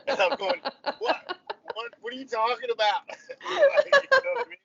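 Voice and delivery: laughing through the words; can't stop laughing while retelling; incredulous giggling. Real, not acted.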